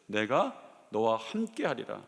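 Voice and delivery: a hint of a low, slow divine voice; lightly voiced God, slow and solemn. Preacher voicing God's promise to Moses, low and slow.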